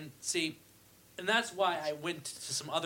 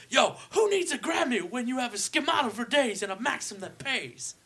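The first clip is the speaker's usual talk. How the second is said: higher put-on [name] voice; 9 semitones higher, 5 dB louder than usual. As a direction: brag it like [name]. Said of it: "[name] voice".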